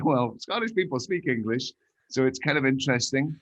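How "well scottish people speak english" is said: a light Scottish lilt on the comeback; a hint of Scottish accent, amused. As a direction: slip back into a Scottish accent to answer. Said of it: "I used to have a beautiful Scottish accent".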